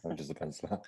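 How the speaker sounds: breathy whisper, barely voiced; whispered aside. Real, not acted. Penciler rehearsing his intro under his breath in a whisper. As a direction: whisper it to yourself. Mumbles line before his turn.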